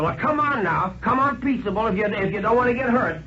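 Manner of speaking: a crook enjoying his upper hand; menacing tramp. A narrator's reading. Tramp threatening to hand the banker to the sheriff.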